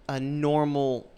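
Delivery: ordinary talk, nothing marked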